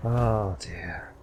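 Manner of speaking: ordinary talk, nothing marked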